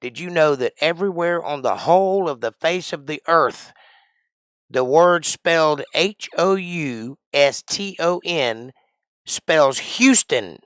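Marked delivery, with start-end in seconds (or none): 4.7-10.6 slow, higher, louder spelling out. Acted. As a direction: spell each letter deliberately, slowly. Spelling out a name slowly.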